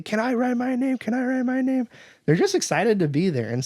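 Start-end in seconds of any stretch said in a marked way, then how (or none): 0.0-1.9 high mock child voices; high-pitched kid voice; eager kids. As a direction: imitate children begging in a high singsong. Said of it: "all the kids say".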